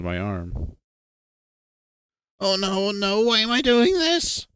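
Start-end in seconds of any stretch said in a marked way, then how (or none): 2.4-4.5 very high wail, growing louder; terrified, high-pitched regret; panicked whimper. Acted. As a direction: build from frightened moaning to a loud wail. TJ panicking, clutching friend's arm.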